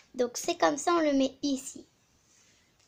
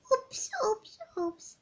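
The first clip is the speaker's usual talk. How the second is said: whispered oops; 10 semitones higher, 3 dB quieter, much slower than usual. Pretend. Mess made, mock whispered dismay.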